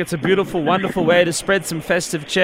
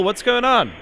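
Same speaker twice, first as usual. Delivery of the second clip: questioning; 5 semitones higher than usual. Real, not acted. Checking on reporter at North Pole.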